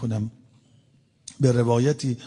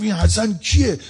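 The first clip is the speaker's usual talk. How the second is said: high, louder confrontational question; acted-out challenge, raised voice; retold hostile demand; 8 semitones higher, 5 dB louder than usual. Hostile stranger demands to know Hasan.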